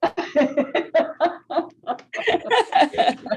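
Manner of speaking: loud, high burst of laughter; real hearty laughter; delighted laugh. Real, not acted. Host delighted by the demo.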